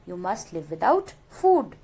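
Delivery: normal talking voice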